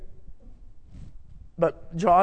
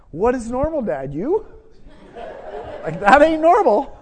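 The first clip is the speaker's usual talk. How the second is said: son's retort; 5 semitones higher than usual. Retold or imitated. Voicing son's imagined retort.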